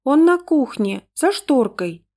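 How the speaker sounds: put-on high, slow little-girl voice; careful, high-pitched child; confiding child. A narrator's reading. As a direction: reveal it in a small child's voice. Child confides where puppy hides.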